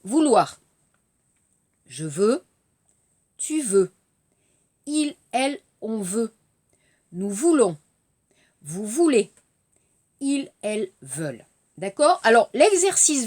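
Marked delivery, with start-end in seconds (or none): 0.0-11.4 conjugation drill. Shown as a demonstration. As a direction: read each verb form out for students to repeat. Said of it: "écoutez d'abord les verbes".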